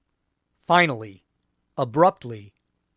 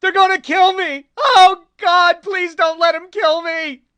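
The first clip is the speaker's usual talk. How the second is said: high, loud, desperate pleading screams; broken Marine screaming in terror; terrified screeching; 8 dB louder than usual. A narrator's reading. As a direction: beg for your life in blind panic. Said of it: "he screeched".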